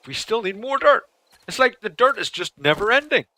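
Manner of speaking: high, loud exasperated whine; loud, high frustrated moan. Real, not acted. Exasperated complaint about dirt.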